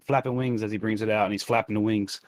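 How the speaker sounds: normal talking voice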